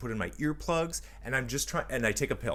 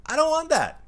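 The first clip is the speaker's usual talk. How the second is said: high, loud mock outburst; comic exasperated cry; exclaimed protest; 5 dB louder than usual. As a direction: blurt it out in high-pitched protest. Mock rant about kids at pool.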